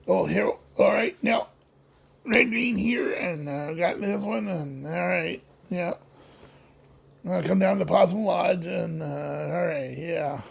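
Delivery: a lightly voiced Red Green; Red Green impression. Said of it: "like I do Red Green's voice".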